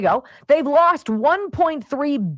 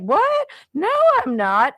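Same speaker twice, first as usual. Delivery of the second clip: high, surprised denial in a put-on voice; mock indignant politician; 11 semitones higher, 3 dB louder than usual. Mocking Jennifer Granholm denying her Proterra stake. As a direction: squeal out a startled denial. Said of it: "was like".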